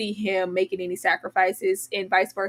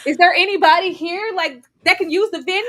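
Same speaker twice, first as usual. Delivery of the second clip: mock cliche line; 5 semitones higher, 4 dB louder than usual. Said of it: "cliche where it's like".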